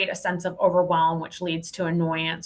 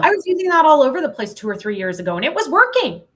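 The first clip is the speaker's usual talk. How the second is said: excited; 9 semitones higher, 7 dB louder, faster than usual. Real, not acted. Excited recall of past success.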